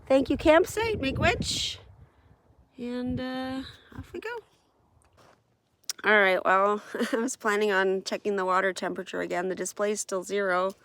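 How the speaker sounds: ordinary delivery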